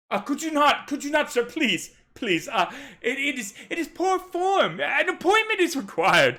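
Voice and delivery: flustered butler guarding his master; fussy servant voice. Acted. Servant stopping the captain at the tent, fussy and flustered. Servant scolds drunk Jonas.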